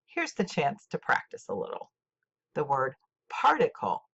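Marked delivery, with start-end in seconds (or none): 3.3-4.0 "particle" as PAR-ti-cle; "particle" with the accent up front; first-syllable stress. Shown as a demonstration. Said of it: "first syllable".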